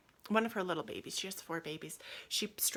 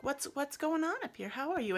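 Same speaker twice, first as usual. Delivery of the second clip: put-on Southern accent; 7 semitones higher than usual. Carol comes back to check, Southern accent. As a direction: ask it high in a Southern accent. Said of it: "Carol also has a southern accent".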